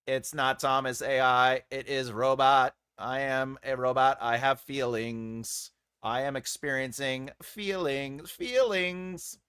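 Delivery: monotone, evenly measured robot. Pretend. Host insisting it is a robot with feelings, robot voice. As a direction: speak like a machine claiming emotions. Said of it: "the robot phase a lot".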